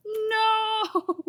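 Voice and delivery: sudden high, loud yelp; shocked wail; startled cry. Real, not acted. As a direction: cry out as it slips. Something goes wrong mid-shot.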